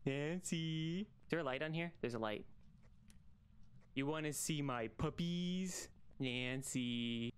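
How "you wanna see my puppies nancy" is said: slow, creepy put-on voice; slow mock creep. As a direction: lure her in like a creep.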